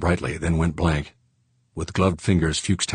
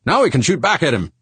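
high, loud and excited; 11 semitones higher, 5 dB louder than usual. A narrator's reading. George finally able to shoot back, excited. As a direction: exclaim it, eager to fight. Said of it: "George said excitedly".

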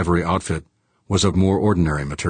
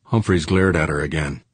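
ordinary delivery, nothing marked

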